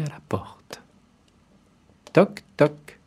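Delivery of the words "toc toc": high voiced knock knock. A narrator's reading. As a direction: tap out the knock. Girl knocks at the door.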